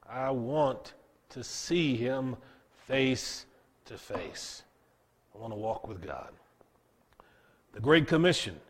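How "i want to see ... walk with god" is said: tender longing. Real, not acted. Longing to be with God.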